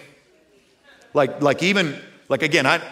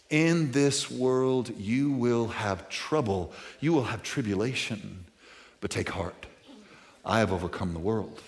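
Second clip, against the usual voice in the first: gentle reassurance; 6 semitones lower, 8 dB quieter than usual. Acted. Quoting Jesus, slow and soft.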